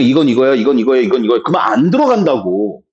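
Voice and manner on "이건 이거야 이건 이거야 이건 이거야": low monotonous rote recital; rote muttering. Acted. Teacher mimicking students learning by rote, monotone recital. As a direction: drone it like mindless memorizing.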